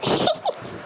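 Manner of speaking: high, airy giggle; light tittering laugh; teasing giggle. Acted. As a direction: giggle at your sweetheart's shyness. Giggles at partner's shyness.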